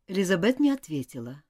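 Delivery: ordinary delivery, nothing marked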